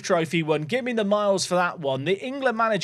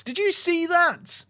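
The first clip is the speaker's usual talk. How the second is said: disbelief; 12 semitones higher, slower than usual. Real, not acted. Disbelief at an assist.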